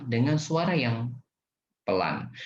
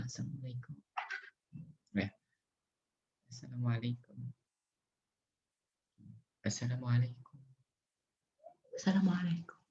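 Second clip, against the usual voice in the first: a much softer, mumbled salam; too quiet greeting; 10 dB quieter, faster than usual. Shown as a demonstration. Teacher demonstrates a greeting too quiet to hear. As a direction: barely say it, almost inaudible. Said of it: "jangan juga mengucapkan salam pelan".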